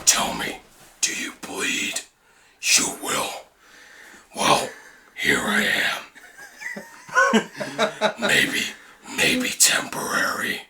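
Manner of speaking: whispered Batman threat; Ben Affleck Batman. Retold or imitated. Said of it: "Ben Affleck".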